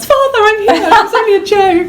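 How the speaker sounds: put-on high, laughing little-girl voice; mock Victorian daughter, laughing; squeaky girl voice. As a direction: play Martha high and giggly. Voicing Martha in retold scene.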